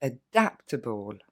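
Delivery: Adaptable said slowly, able unstressed; slow model word. Shown as a demonstration. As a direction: stretch adaptable, weak final syllable. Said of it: "Encore une fois". (Adaptable lentement, fin non accentuée)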